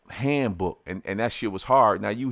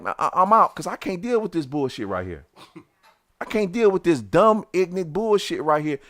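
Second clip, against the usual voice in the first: higher, fed-up rant; angry exasperation; 7 semitones higher than usual. Retold or imitated. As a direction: storm off fuming. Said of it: "I'm like".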